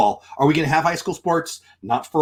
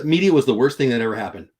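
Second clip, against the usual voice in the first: ordinary delivery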